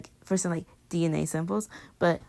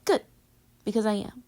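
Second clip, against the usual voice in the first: ordinary talk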